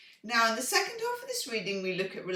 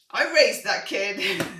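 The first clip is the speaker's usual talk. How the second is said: laughing; 5 semitones higher, 9 dB louder than usual. Real, not acted. Laughing about her Aries son.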